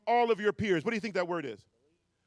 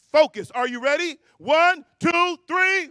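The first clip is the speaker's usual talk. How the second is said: hype count; 7 semitones higher, 8 dB louder than usual. Real, not acted. Speaker firing up the ballroom crowd to yell, loud rising count.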